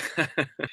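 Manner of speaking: soft short chuckle; laughing. Real, not acted. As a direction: let out a quiet laugh. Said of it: "I'm laughing".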